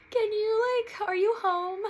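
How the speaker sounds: high, loud tearful ask; choked-up plea to her sister over the phone; teary plea. Retold or imitated. Calling her sister before coming out, tearful and high.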